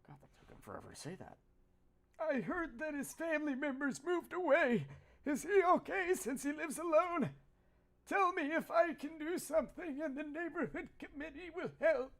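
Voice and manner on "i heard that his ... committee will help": higher, brighter kindly uncle voice; cheerful old neighbour greeting; friendly neighbour uncle. Pretend.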